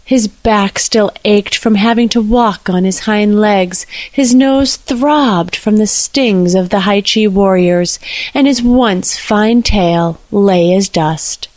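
usual speaking voice

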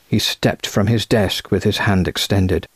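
plain reading voice

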